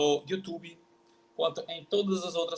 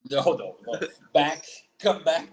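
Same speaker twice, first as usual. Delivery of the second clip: urgent, louder no, no, no, then come back; louder correction; 6 dB louder than usual. Real, not acted. Teacher loudly stopping his guest's wrong try. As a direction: stop the learner mid-mistake. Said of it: "correcting the learner".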